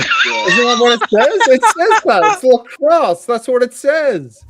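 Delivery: laughing. Real, not acted. Laughing protest over lacrosse.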